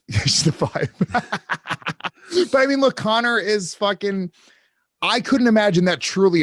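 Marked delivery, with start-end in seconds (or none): 0.0-2.5 chuckling through the words, slow; laughing. Real, not acted. Co-host laughing at his own quip about the rating. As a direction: laughs out the correction.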